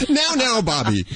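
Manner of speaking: laughing. Real, not acted. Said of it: "I can't keep a straight face now".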